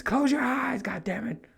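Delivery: high, urgent shout; shooing the kids. Retold or imitated. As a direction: hurry them off, flustered.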